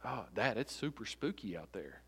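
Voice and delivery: soft, low imitation of a spooked little boy; hushed, lightly acted unease; mildly scared child. Father imitating his five-year-old telling Dad, hushed mild fear. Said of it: "scared, he says".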